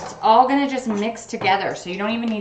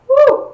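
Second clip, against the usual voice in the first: sharp high exhale, louder; high loud whew; 9 dB louder than usual. Real, not acted. Onion fumes stinging eyes.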